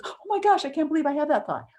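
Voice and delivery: high, startled "oh my gosh" at herself; shocked gasp. Retold or imitated. Friend horrified at her own angry thought, high shocked voice. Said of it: "She goes".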